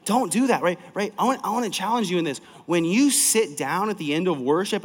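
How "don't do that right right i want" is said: ordinary talk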